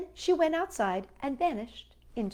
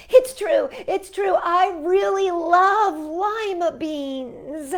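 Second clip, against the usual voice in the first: loud, high, pleading admission; crying out confession; 9 semitones higher, 9 dB louder, much slower than usual. A narrator's reading. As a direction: blurt it all out at last. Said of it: "she cried".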